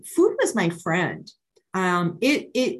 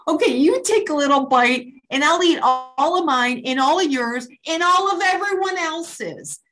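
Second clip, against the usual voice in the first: higher, louder and fast, gleeful; hammed-up greedy offer; 8 semitones higher, 4 dB louder than usual. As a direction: rush through it like you'll eat everything.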